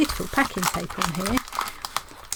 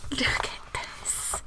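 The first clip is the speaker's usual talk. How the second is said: soft hushed whisper, slowing down; 7 dB quieter, much slower than usual. Real, not acted. Whispering to viewers while focusing the camera. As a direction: breathe the words, barely voiced.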